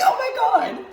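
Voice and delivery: sudden thrilled gasp of memory; excited realization. Retold or imitated. Hungover host recalling a food discovery, excitedly. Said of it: "And then I was like".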